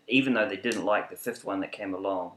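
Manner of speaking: ordinary talk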